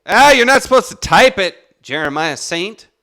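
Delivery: high, loud outburst; real loud objection. Real, not acted. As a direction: call them out at full voice. Calls out listener for typing.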